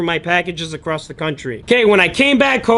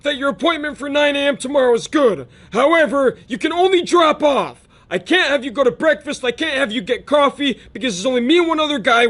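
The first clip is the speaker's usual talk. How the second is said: higher-pitched, hurried shop-owner voice on the phone; impersonating Mister Mark; 6 semitones higher than usual. Retold or imitated. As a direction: play the grumpy mechanic calling to lay down rules, pitched up. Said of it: "and he's like".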